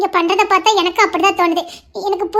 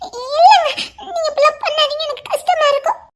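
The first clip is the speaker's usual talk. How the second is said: laughing through tears; 5 semitones higher than usual. Acted. Wife half laughing, half crying.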